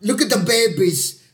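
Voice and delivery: hammed-up bad movie accent, pitched high; mock a terrible film accent, stretching the last words; Jon Voight. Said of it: "an accent or something?".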